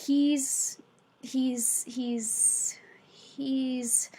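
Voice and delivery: hesitant stammer, fading toward a whisper; stuttering. A narrator's reading. Teacher unsure how to talk about Rowdy, stuttering. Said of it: "Mister P stuttered".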